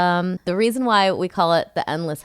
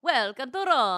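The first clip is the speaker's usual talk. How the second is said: higher, echoed Italian greeting; Italian accent; 6 semitones higher, 4 dB quieter than usual. Shown as a demonstration. Partner echoing the welcome to Roma, Italian accent. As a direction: repeat the welcome with an Italian lilt. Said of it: "How about an Italian?".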